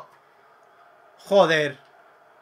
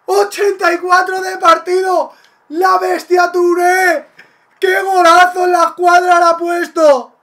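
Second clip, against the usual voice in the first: breakneck goal commentary; 6 semitones higher, 7 dB louder than usual. Real, not acted. Celebrating a goal just scored.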